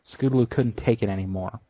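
usual speaking voice

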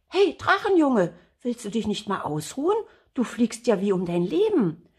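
high, lively call to a stranger. Acted. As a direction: call over to the dragon boy. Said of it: "als es ihm etwas zuruft".

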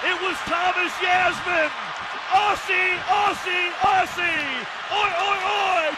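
high, celebratory chant-like shouts; exultant touchdown call. Real, not acted. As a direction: ring out each cry for the crowd.

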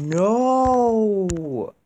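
high, loud drawn-out cry of protest; dismayed shout. Real, not acted. Gamer protesting at the game, loud dismayed shout. Game cuts off the audio log.

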